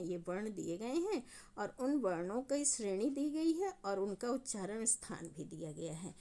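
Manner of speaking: ordinary delivery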